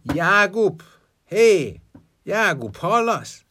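loud, high shout through the window; calling out loudly. A narrator's reading. Death shouting for Jágub through the window. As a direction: bellow his name to be heard inside. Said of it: "és elkiáltotta magát".

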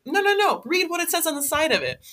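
high-pitched imitation of a boss cutting in; mock insistent boss. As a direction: interrupt like a bossy manager, pitched well up. Boss insisting, acted in retelling.

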